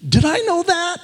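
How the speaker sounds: playful husband acting clueless; confused puppy-dog question. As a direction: ask it like a baffled puppy. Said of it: "kinda like a, a puppy dog".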